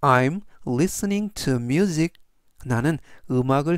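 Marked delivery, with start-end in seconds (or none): 0.0-2.1 very slow, careful English; slow model sentence. Shown as a demonstration. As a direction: read it slowly for learners. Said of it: "전체적 의미는". (아주 천천히 또박또박 읽는 예문)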